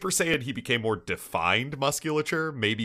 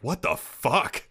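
slow, higher-pitched mock disbelief; Piccolo messed up; 7 semitones higher, slower than usual. Host quoting a messed-up character's reaction, mock stunned. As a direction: draw out a retold stunned reaction. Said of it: "Piccolo is messed up by this, being like".

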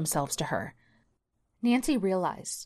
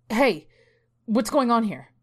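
higher, louder demand at the driver; a father confronting the driver, indignant; 5 semitones higher, 6 dB louder than usual. A narrator's reading. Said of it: "Jen's father demanded".

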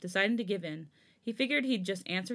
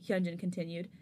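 ordinary delivery, nothing marked

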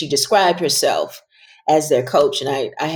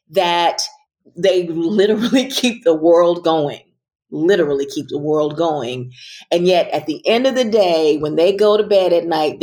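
ordinary talk

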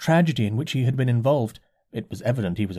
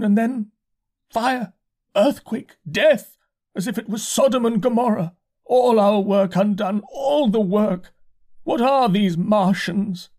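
complaining curate; 9 semitones higher than usual. A narrator's reading. Shaken curate complains to the narrator, high and whining. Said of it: "spoke in almost a complaining tone".

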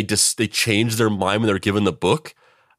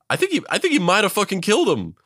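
normal talking voice

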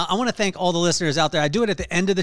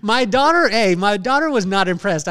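loud excited burst; 5 semitones higher, 8 dB louder than usual. Real, not acted. Excited start to daughter story.

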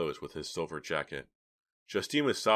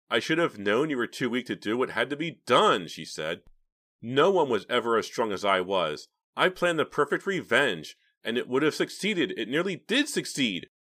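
higher, loud, proud sneer; mad gloating; 6 semitones higher, 6 dB louder than usual. A narrator's reading. Justine sneering at her weak uncle, mad gloating. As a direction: boast with cold scorn.

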